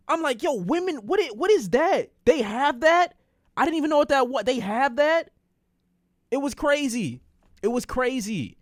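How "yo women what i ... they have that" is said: high-pitched astonished questioning; amazed disbelief. Retold or imitated. Reacting to what women have, amazed and high. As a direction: ask it like a stunned first discovery.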